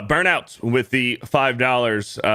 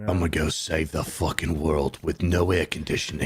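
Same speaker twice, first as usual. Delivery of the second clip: lower-pitched mock action-hero voice; movie tough guy; 5 semitones lower, 4 dB quieter than usual. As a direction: play the action star, pitched down. Voicing Statham's character in Crank.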